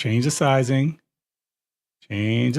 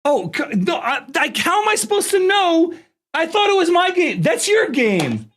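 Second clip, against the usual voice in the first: indignant protest; 14 semitones higher than usual. Real, not acted. Defending himself to his brother.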